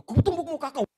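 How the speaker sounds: loud, high mock shout; haunting angry threat. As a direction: replay the threat like it echoes in your head. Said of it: "penampakan wajah Bapak itu".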